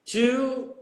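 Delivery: normal talking voice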